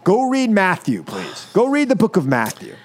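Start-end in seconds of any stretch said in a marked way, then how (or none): none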